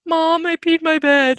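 put-on high, slow childish confession; a lightly voiced guilty child; little kid voice. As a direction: play a small child owning up. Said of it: "be like".

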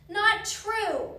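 high, loud contradiction; emphatic denial. A narrator's reading. Annie contradicts the reassuring men.

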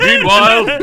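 loud, high cartoon announcer; mock announcer. Co-host parodying scene transitions for laughs, booming announcer. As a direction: boom it like a scene-change narrator.

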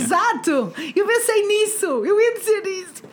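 high, eager shouts of agreement; excited. Real, not acted.

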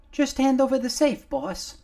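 a little face on a shoulder gulping fresh air; little Nomar. Acted. Nomar freed from under the clothing, high and loud.

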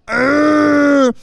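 cartoon bite yell; loud biting grunt. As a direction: chomp down with a yelled grunt. Biting sound in fight.

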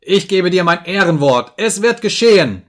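loud, high, furious insistence; shouted vow. A narrator's reading. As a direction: swear it at the top of the voice. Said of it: "schrie ich".

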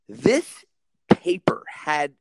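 ordinary talk, nothing marked

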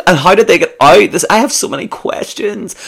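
squeaky, disbelieving shout; shocked high voice. Acted. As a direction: shriek the questions in disbelief.

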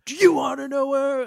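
high, loud yelled song line; yelled lyric. Retold or imitated. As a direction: belt it out like a punk singer screaming. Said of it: "a yelled Mark lyric a la party song".

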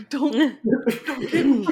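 half-joking plea not to be taken; mock pleading. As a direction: plead through laughter. Pleading with a stranger, mock scared.

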